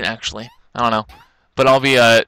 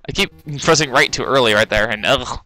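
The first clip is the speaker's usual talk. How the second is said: ordinary delivery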